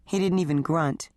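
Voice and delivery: plain reading voice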